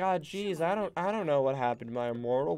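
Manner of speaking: hammed-up sheepish explanation; imitating hapless players. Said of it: "And they're like".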